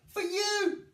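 put-on high, bright little boy's offer; proud child handing over a gift; beaming. A narrator's reading. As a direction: toddler beaming as he offers toys. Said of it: "he beamed".

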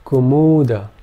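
Kumuda said clearly for listening; demo word. Shown as a demonstration. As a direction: Kumuda with the long vowels held. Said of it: "Let's try another example".

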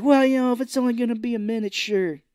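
high, quick, put-on agreeable voice; mock obliging son. Retelling Owen letting the cops in, mock agreeable. Retold film line to the cops.

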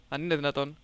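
usual speaking voice

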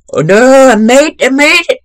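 high, loud delighted squeal; breathless high victory shriek; excited squeal. Real, not acted. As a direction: shriek it out in disbelief and joy. Squealing on clearing the level.

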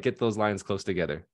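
normal talking voice

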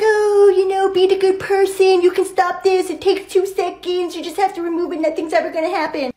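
sing-song goody-goody angel voice; put-on angel, pleading sweetly; genie angel. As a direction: coax like a little conscience on the shoulder. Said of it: "So the genie angel was like".

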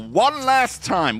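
slow, high-pitched hype announcement; drawn-out hype call. Real, not acted. Commentator brings viewers back for the finale, drawn-out hype. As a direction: stretch it out high for the crowd.

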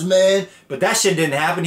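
ordinary talk

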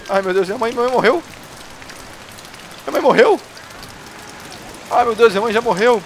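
high, loud disbelief; shocked outcry. Real, not acted. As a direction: cry out in horror at the news. Shocked his mother died.

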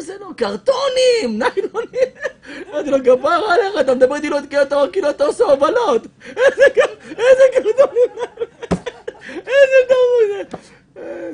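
high mock-outraged laughter; laughing incredulity. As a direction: giggle in disbelief at the slang. Laughing at the dealer's slang.

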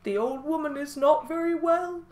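owl voice. Acted. Owl tells the animals the widow is ill, owl voice. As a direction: speak as a worried owl. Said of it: "And the owl said".

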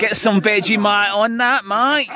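hammed-up Aussie accent, pitched high; terrible mock-Australian voice; bad Australian accent. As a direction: do a cod Australian, ending high on "mate". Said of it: "my bad Australian accent".

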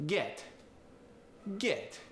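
"get, get" with one Italian eh vowel, pitched high; Italian-accented "get", open eh for both words; Italian E sound. Shown as a demonstration. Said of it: "They both get the E sound".

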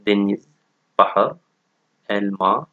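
usual speaking voice

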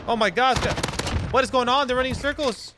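high, excited cheering; excited gamer hype. Real, not acted. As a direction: cheer on the win. Continued hype while winning.

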